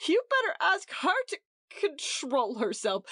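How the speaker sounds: high, crying voice; a crying wife telling the host to rein in his own wife; tearful retort. A narrator's reading. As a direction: hurl it back through tears. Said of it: "exclaimed Edith brokenly".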